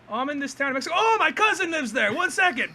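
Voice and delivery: high, lively imitated exchange; cartoon phone chatter. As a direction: act out both ends of a cartoon call, high-pitched.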